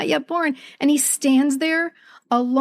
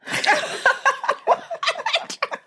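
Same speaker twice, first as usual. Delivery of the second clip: high, helpless laugh at the memory; burst of laughter; 14 semitones higher than usual. Real, not acted. Remembering the moviegoer, she bursts into high laughter.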